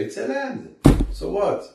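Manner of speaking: high-pitched dismissive "so what"; mock scoffing. Voicing the spies' scoff at Israel.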